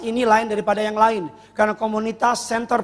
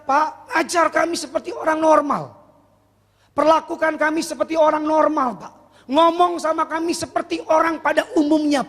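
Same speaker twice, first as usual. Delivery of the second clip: earnest plea; 7 semitones higher than usual. Retold or imitated. Students pleading to be taught normally.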